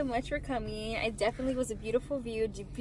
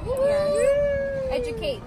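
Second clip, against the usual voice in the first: excited cheer; 12 semitones higher, 6 dB louder, much slower than usual. Real, not acted. Cheering friend's teacher plans.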